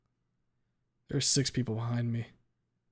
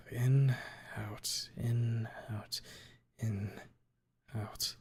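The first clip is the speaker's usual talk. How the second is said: soft, fading in and out; calming breath count; 6 dB quieter, much slower than usual. A narrator's reading. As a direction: count breaths down to almost nothing. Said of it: "Breathe in, breathe out".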